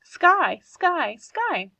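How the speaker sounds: high cry of the name, fading each time; boy crying out to his injured dog; calling the hurt dog. Acted. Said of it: "and I said".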